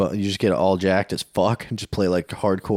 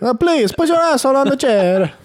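put-on Mexican accent; 5 dB louder than usual. Accent sketch continues.

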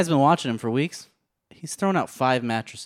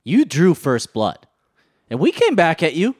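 mock threat; 5 semitones higher, 5 dB louder than usual. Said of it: "You're on notice".

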